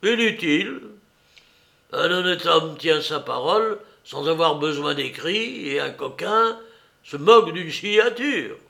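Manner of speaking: lightly voiced hoarse master, forceful; muffled, hoarse master. A narrator's reading. Master waving away a signed contract, lightly hoarse and emphatic. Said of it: "la même voix à la fois assourdie et rauque".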